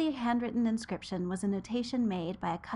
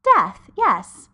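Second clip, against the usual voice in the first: emphatic; 3 semitones higher, 13 dB louder than usual. Acted. Susan confirms grandfather is Death.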